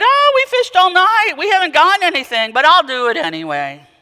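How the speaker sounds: high, loud, weary fisherman protest; exasperated Peter. Acted. Peter protesting to Jesus after fishing all night, high and loud. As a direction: sigh it out, tired but willing.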